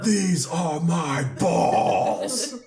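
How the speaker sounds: mock battle cry. Riffing on the Sparta scene for friends, mock battle cry. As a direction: roar it very slowly, like a war cry.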